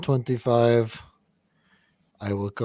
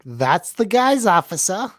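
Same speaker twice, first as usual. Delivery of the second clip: slow, lightly put-on New York accent; a hint of a New York tough guy; 7 semitones higher than usual. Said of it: "That's my New York accent".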